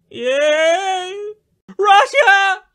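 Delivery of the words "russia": high, wailing cry of Russia; dramatic mock outcry. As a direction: wail the name dramatically.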